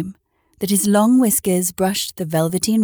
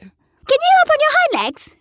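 high, loud probing question; furry rabbit asking; 4 dB louder than usual. A narrator's reading. Furry rabbit probes the toy rabbit, high and loud. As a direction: poke at his weak spot. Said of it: "asked the furry rabbit".

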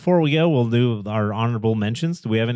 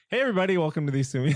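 usual speaking voice

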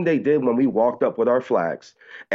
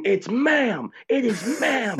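very high-pitched mock outrage; mock scream; 13 semitones higher, much slower than usual. Trolling after being called sir, mock screaming. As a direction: shriek it way up high, hammed-up. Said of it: "I started screaming".

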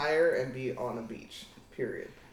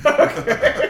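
high, loud burst of real laughter; laughing; 11 semitones higher, 12 dB louder than usual. Real, not acted. Reacts laughing to cartel living joke.

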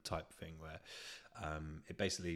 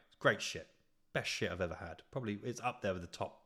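ordinary talk

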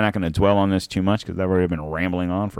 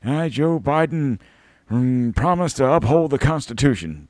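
Joe Biden impression; 7 semitones higher than usual. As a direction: ham up a doddering politician taking the oath. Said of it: "And that was".

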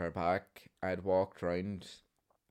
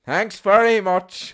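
slow, loud, higher huffy thanks; sarcastic loud thanks; 13 semitones higher, 17 dB louder, slower than usual. Retold or imitated. Leaving the shop in a huff, loud sarcastic thanks. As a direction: fling it back sarcastically.

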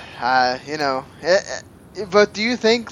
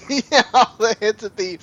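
laughing; 7 semitones higher, 6 dB louder than usual. Real, not acted. Echoes the joke, laughing.

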